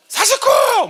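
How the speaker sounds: forceful shout. Retold or imitated. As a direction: bellow the count like a keen recruit. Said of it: "힘차게 혼자 또 외치는". (힘차게 외치는 구호)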